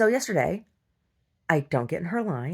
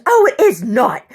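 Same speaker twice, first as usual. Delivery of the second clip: mock indignant retort; 6 semitones higher, 12 dB louder than usual. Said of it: "She goes".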